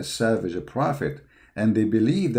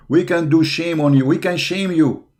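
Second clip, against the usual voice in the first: ordinary talk, nothing marked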